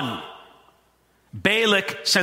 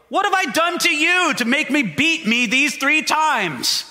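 higher, plaintive donkey voice; 7 semitones higher than usual. Acted. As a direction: play the hurt donkey pleading.

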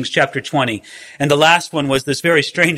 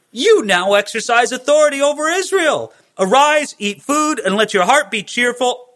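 Jezebel urging; 7 semitones higher than usual. Acted. Jezebel rousing Ahab, read aloud.

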